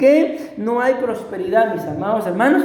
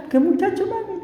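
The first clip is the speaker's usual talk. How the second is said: higher, small voice playing dumb; innocent child; 10 semitones higher, 3 dB quieter than usual. Boy to his mother, faking innocence in a raised voice. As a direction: act the boy who knows nothing.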